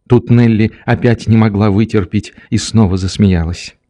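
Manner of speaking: plain reading voice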